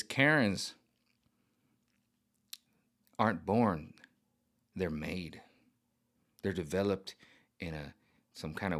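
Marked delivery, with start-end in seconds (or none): none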